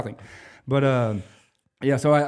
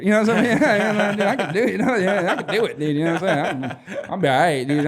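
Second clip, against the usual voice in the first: laughing; 7 semitones higher, 6 dB louder than usual. Real, not acted. Laughing bragging.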